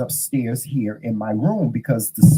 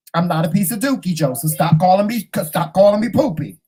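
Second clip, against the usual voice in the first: raised-pitch playful complaint; mock exasperated protest; 5 semitones higher than usual. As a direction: demand it with comic indignation. Retold protest over nickname.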